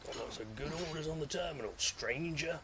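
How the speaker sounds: a hint of the shopkeeper merchant; lightly voiced shady merchant pitching wares. Streamer trying the merchant voice for viewers. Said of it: "I can't do the merchant voice".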